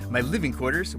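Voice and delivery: usual speaking voice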